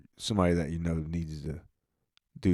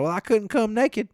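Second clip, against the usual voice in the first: high mock lady's voice, much higher; high mock female quip, a bit louder; 5 dB louder than usual. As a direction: play the gas station lady with a sassy squeak. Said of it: "She's like".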